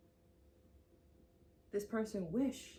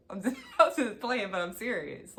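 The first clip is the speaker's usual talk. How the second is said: laughing, much louder; 2 semitones higher, 10 dB louder than usual. Real, not acted. Reader laughing off her mock scolding of the viewer. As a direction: laugh through the words. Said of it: "I'm laughing, but I'm serious".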